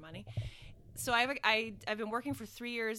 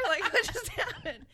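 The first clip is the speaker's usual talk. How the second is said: laughing; 12 semitones higher, 10 dB louder than usual. Real, not acted. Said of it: "laughing at the mixup".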